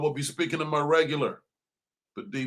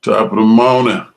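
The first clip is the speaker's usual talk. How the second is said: loud opener; 3 semitones lower, 13 dB louder, much slower than usual. Acted. Loud radio show opener.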